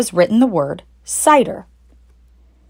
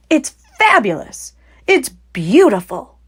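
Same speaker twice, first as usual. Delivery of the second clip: higher, rapt delight; breathless awe; 6 semitones higher than usual. Acted. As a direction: fox father overwhelmed by cider. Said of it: "fighting for breath".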